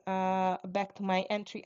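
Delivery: ordinary talk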